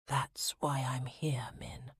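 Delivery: slow, unvoiced, raw whisper. Man confessing to the woman he loves, raw whisper. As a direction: drop to a raw, aching whisper. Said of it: "his voice dropped to a raw whisper".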